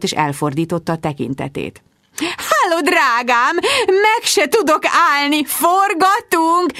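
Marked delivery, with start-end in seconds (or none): 2.2-6.7 magas, elnyújtott trillázás; trillázd magasan, a végét hosszan nyújtva; trillázó anya. A narrator's reading. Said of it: "trillázta anyám". (magas hangon trillázó anyuka)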